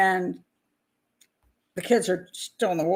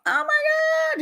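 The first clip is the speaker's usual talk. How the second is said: high-pitched, drawn-out mock panic wail; mock freak-out; much slower than usual. Narrator imagining how the father would react, mock freaking out. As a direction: imitate someone freaking out, very high and stretched. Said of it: "he would be freaking out".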